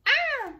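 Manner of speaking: sharp high squeal; a sudden loud high squeal; high yelp. Real, not acted. Sudden cry after laugh.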